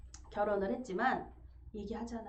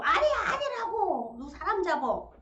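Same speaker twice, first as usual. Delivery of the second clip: much higher, louder protest; wife protesting an accusation, higher and louder; strong denial; 8 semitones higher, 8 dB louder, slower than usual. Retold or imitated. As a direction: cry out in denial. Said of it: "강한 부정".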